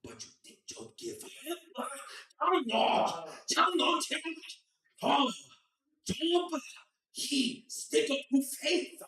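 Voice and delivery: high, loud, slow sermon voice in broken English; preachy parent storyteller. Retold or imitated. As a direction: preach the story of Job like a strict dad. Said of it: "he'd be like".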